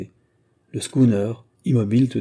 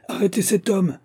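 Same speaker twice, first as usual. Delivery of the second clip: much higher, urgent call; shouted order; 11 semitones higher than usual. A narrator's reading. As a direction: call out to stop a fugitive. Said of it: "cria-t-il à l'un des pêcheurs".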